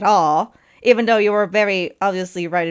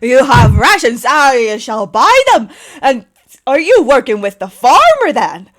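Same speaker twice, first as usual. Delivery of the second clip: eager knight; 3 semitones higher, 8 dB louder than usual. Acted. GM voices loud eager knight.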